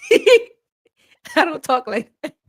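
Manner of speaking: real laughing through the words; helpless high-pitched laughter while talking; cracking up. Real, not acted. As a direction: barely get it out for laughing. Cracking up at own impression.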